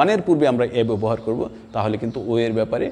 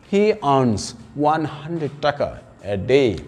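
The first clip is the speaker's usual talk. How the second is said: slow example reading; much slower than usual. Shown as a demonstration. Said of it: "a day হবে".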